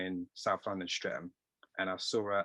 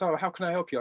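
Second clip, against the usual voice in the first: higher, lightly voiced posh English tailor; a hint of a very posh shop manager; 9 semitones higher than usual. Posh tailor greeting a job applicant, lightly imitated. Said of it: "very, very posh".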